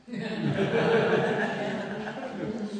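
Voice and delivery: high breathless laugh of delight. Real, not acted. Preacher laughing at the cat food sign, high and breathless. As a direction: let a breathy laugh burst out.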